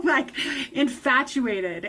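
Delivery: ordinary delivery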